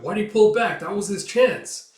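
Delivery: high, loud disbelief; agitated onlooker. Acted. Spectator seeing the boy retreat, agitated cry. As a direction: protest the missed chance. Spectator frustrated at missed chance.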